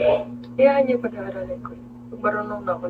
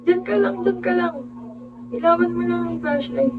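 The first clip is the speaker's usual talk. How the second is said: higher, insistent orders to stay there; urgent retold instruction from the water; urgent command; 8 semitones higher than usual. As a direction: tell them firmly to stay put. Friend ordering to stay put.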